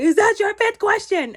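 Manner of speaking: very high, loud imitation; acted challenge. He challenges the questioner during roulette, acted challenge. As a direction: mimic him throwing out a pointed question.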